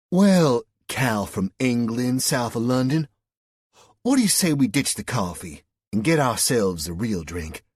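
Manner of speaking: Southern woman inviting him for a drink; flirty Southern. A narrator's reading.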